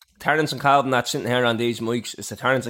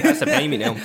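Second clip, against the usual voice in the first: laughing. Real, not acted. Joking that his mate owes money.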